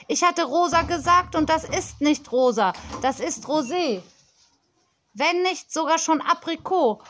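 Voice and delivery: put-on a pampered child scolding a supplier; spoilt girl on phone.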